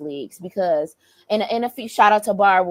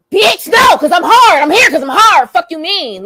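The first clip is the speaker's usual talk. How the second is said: much louder and higher indignant yell; offended shout; 9 semitones higher, 11 dB louder than usual. Retold or imitated. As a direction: shout him down, insulted. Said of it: "that made me mad as hell".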